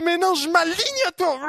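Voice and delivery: high shouted cry of surrender. Acted. Believer yielding to God, high shouted cry. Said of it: "Et là, on dit".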